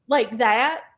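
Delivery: usual speaking voice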